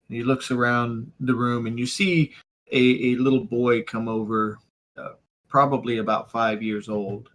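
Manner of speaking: ordinary talk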